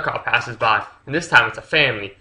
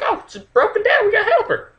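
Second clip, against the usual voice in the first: much higher mock worried voice; high concerned family; faster than usual. Family spots the broken-down trailer, much higher mock concern. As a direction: play the eager helpful family. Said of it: "it's like".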